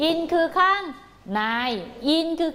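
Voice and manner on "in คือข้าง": high, rising prompt that waits for an answer; teacher prompting the class to finish. Shown as a demonstration. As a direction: lift the end and leave it open.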